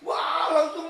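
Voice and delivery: loud, high exclamation; retold alarm. Recounting a childhood mishap to the audience, loud exclamation. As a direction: burst out in mock alarm. Excited retelling of the car incident.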